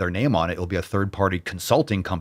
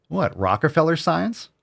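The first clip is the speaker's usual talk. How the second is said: usual speaking voice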